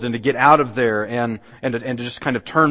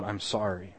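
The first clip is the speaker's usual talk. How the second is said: soft, tearful "I'm sorry"; tearful apology; 3 semitones lower, 10 dB quieter than usual. A narrator's reading. A weeping woman apologizes, softly tearful. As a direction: let the apology come out soft through tears. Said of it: "and tears begin to flow out of her".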